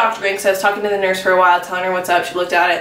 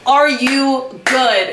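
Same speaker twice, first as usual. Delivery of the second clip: mock annoyed nurse; 5 semitones higher, much slower than usual. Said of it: "they're probably just like".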